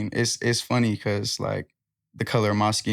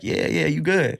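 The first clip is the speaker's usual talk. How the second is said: dismissive yes-man; 5 semitones higher than usual. Retold or imitated. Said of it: "I'm not just gonna be like".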